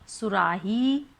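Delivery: सुराही ending on a lengthened "heee"; long final vowel. Shown as a demonstration. Teacher recaps the example, last syllable stretched. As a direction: draw the ही of सुराही out a little. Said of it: "ही थोड़ा सा लंबा खिंच रहा है".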